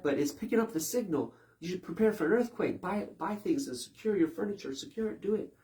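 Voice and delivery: high, loud, fast panicky warning; frantic mock scientist selling doom; mock earthquake alarmist. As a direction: rush them into fear, pushing harder.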